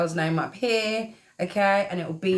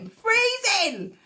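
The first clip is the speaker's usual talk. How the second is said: mock shivering; 13 semitones higher, slower than usual. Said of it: "It's so cold here today".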